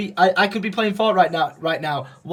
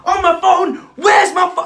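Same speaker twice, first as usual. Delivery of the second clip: high, loud disbelieving yell; retelling the argument, outraged at the driver; indignant shout; 13 semitones higher, 7 dB louder, slower than usual. Retold or imitated. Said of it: "I was like".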